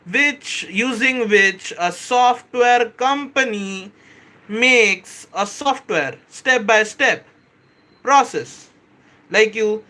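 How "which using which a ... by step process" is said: deliberately slow, spaced-out explaining; slowed-down explanation. Shown as a demonstration. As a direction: stretch every phrase out for the chat. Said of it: "I'm gonna slow down".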